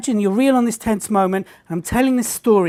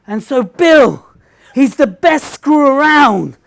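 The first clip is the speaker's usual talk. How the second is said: repeated slip, louder; 3 semitones higher, 6 dB louder, slower than usual. Retold or imitated. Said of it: "I say it again".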